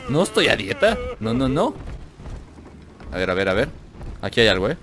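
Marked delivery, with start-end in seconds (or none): none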